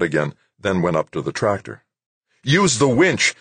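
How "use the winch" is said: higher, projected instruction; raised, urgent shout to helper; calling out. A narrator's reading. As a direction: call across a distance. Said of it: "Doug called to her".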